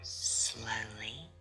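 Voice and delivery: usual speaking voice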